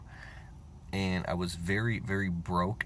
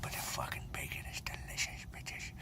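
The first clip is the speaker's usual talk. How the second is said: mock whisper; 5 semitones lower, 12 dB quieter than usual. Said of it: "you're like".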